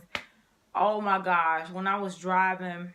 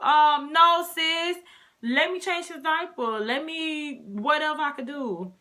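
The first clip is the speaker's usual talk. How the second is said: emphatic loud refusal; 8 semitones higher, 5 dB louder, slower than usual. Real, not acted. Firm refusal to viewers.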